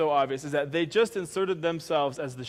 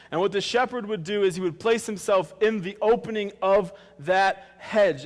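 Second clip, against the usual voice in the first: louder, higher preaching push; 3 semitones higher, 6 dB louder than usual. Acted. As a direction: drive the story home to the congregation. Preacher raises intensity.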